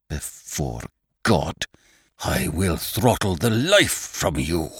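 hoarse savage threat; savagely hoarse. A narrator's reading. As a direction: rasp it out in fury. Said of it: "in a voice savagely hoarse".

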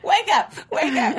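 high, loud imitated calling; acted wake-up call. Host retelling Priscilla at the door, mock calling. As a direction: call it out twice, mockingly.

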